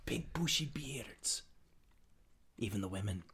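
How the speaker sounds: whisper. Acted.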